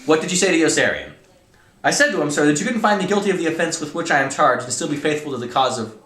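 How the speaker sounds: loud, high-pitched heated interrogation; dramatic story reading. Acted. Colonel grilling cadets at trial, loud and exasperated. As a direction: act out a furious colonel and protesting cadets at full volume.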